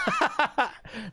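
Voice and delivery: high rolling laugh. Real, not acted. Streamer amused by inescapable habit, laughing high.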